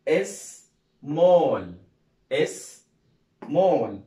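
small said loud as smol, falling; loud classroom model of small; model pronunciation. Shown as a demonstration. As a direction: pronounce small for the class to copy. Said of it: "اسمها إيه؟".

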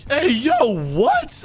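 high, squeaky jump on the last word; shocked disbelief. Pretend. Player accused of being a boy, shocked. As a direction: react in mock outrage, leaping up in pitch.

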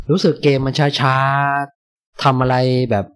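very slow, dragging delivery; drawn-out sluggish. Retold or imitated. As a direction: stretch the words slowly like the dull game. Describing a sluggish slow game. (พูดยืดช้าๆ เลียนแบบเกมที่อืดอาด)

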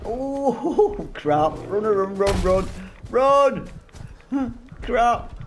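panicked laughing screams; high frantic yells, running scared; terrified horror victim. Real, not acted. Beast throwing rocks, running.

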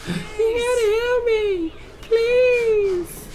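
put-on high, slow, crying little girl voice; man doing a woman's voice. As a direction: whimper it like a helpless little girl. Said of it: "Sound like a little girl".

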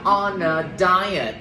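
"On a diet" slowly, with "On a" run together as "o-na"; linked words. Shown as a demonstration. Teacher showing the class linking, slow "On a diet" with "o-na" joined. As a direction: link the n of "On" into "a" in a drawn-out "On a diet". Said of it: "thầy sẽ đọc nối các bạn ha".

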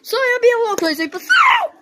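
high, fast and giddy; bubbling over with excitement; excited. Real, not acted. After midnight cheering.

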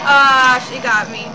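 loud sudden cry; startled yell. Real, not acted. Gamer caught by the monster, yelling. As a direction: yelp as she grabs you.

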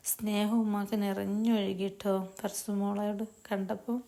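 ordinary delivery, nothing marked

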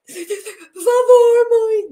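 high, loud, drawn-out whimpering plea; mock crying plea. A girl begging her mother not to beat her, hammed-up crying. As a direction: ham up a little girl begging through tears. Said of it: "Eu já ia chorando".

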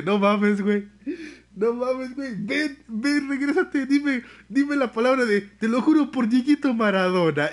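high, loud and thrilled, pitch climbing; loud, high, giddy plea to come back; excited fangirling. Retold or imitated. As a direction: gush at him like a starstruck fan. Excited reaction to the Argentine man.

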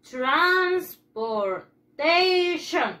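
each syllable drawn out for the class; slow, drawn-out classroom model; slow teaching drawl. Shown as a demonstration. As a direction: stretch it slowly so children can copy. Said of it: "teacher stretching new vocabulary".

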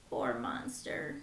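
soft, whispered sympathy; gentle whispered pity. Acted. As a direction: sigh it out for the poor monster. Pitying the lonely monster.

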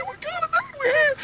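very high, breathy squeak; boss's high-pitched voice. Retold or imitated. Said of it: "Had a real high-pitched voice".

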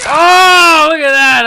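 high, loud amazed shout; excited shout. Real, not acted. Gamer yelling at a surprise on screen, amazed shout. Amazed at reaching new level.

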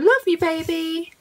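doting pet-talk voice; cooing to a pet. Real, not acted. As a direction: coo it lovingly, drawn out. Cooing to her cat.

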